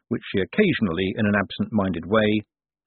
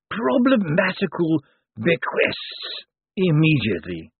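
put-on slow, high, dusty old lawyer; zombie lawyer voice; 8 semitones higher, slower than usual. A narrator's reading. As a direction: draw out each word like a zombie. Slant finishes.

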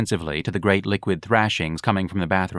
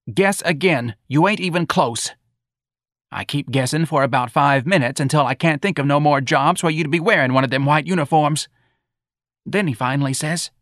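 high, animated complaining voice; aggrieved mother; 9 semitones higher, 3 dB louder than usual. Acted. Mrs. Riley recounting her son's insolence, aggrieved. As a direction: build the tale into an indignant lament.

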